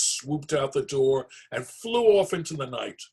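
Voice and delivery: plain reading voice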